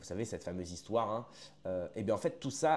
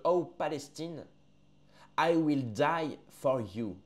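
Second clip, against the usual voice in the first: very slow, weighty English line; slow solemn quote of the poem; solemn quoted line; 4 semitones higher, 3 dB louder, much slower than usual. Acted. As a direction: let each word land slowly.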